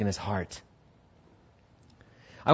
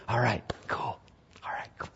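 whisper; 5 semitones lower, 14 dB quieter than usual. Retold or imitated. Joseph alone, whispered.

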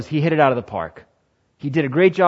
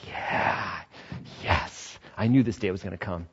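gloating whisper; 5 semitones lower, 9 dB quieter than usual. Acted. Young man anticipating promotion.

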